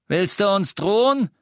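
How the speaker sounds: loud, high indignant question. A narrator's reading. Death Eater to defiant innkeeper, loud challenge. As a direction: challenge the threat loudly.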